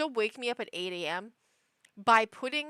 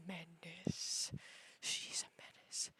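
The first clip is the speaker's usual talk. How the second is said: hushed, voiceless whisper; 6 semitones lower, 17 dB quieter, slower than usual. Real, not acted. Owner confiding about her complete menace of a cat, whispered. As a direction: whisper it like a grave verdict.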